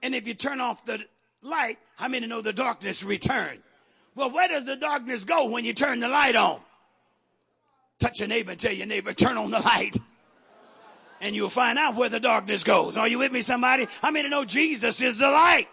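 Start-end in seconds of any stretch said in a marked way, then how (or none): 4.1-15.7 loud call to the congregation; rally the room, building to a shout; rousing preaching. Real, not acted.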